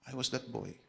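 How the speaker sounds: quiet, understated confession; imitated tearful man, hushed; retold tearful revelation. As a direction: softly quote a man moved to tears. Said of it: "Umiyak yung lalaking pilay".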